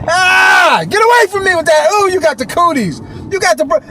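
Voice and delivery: high, loud recoil in pretend disgust; hammed-up playground panic; mock-horrified yell. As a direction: shriek as if fleeing cooties. Mock recoil from broke cooties.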